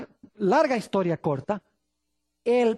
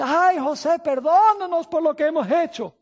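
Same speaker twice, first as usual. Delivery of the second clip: higher, louder mock-weeping plea for forgiveness; tearful plea; 6 semitones higher, 4 dB louder than usual. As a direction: brothers begging Joseph's pardon, a touch of tearful pleading. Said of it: "empiezan a llorar".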